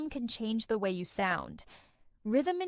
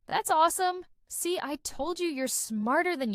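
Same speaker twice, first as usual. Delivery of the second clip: higher, encouraging cheer for a brother; enthusiastic praise; 6 semitones higher, 3 dB louder than usual. Retold or imitated. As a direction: cheer them on with energy. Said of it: "adds enthusiasm and energy to conversations".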